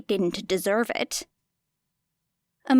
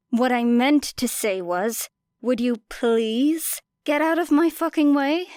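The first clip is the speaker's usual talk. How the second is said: mock-polite fury; 3 semitones higher, 3 dB louder than usual. A narrator's reading. Mock-polite, angry retort.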